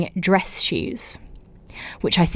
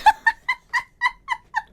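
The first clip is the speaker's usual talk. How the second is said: helpless squealing laugh; high burst of laughter. Real, not acted. Host reading a listener story cracks up laughing. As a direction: break into a high giggle fit.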